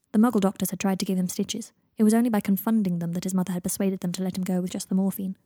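plain reading voice

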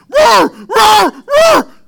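shouted high barking, very loud; frantic dog barking. Retold or imitated. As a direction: bark wildly like a dog charging the fence.

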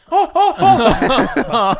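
mock amazed gasps. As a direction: ham up a stunned gasp of wonder. (överdrivet förundrade "åh", långsamt, högt och skrattande)

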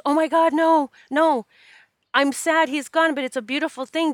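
high-pitched, imitated friend's insistent reassurance; emphatic reassurance. Bereaved friend easing the caller's guilt, emphatic retold reassurance. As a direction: act out a friend rushing to absolve you, loud at first.

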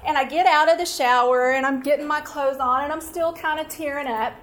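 ordinary talk

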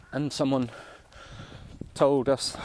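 breathless from climbing a hill; puffing between words; out of breath. Real, not acted. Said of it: "I'm really out of breath".

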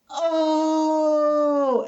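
high excited crowd "Oh!"; crowd cheer. Retold or imitated.

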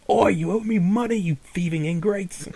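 high, loud mock-angry shout; hammed-up outraged shopkeeper; indignant fist-shaking yell. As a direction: holler after fleeing debtors. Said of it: "lightly shaking his fist".

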